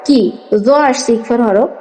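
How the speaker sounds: ordinary talk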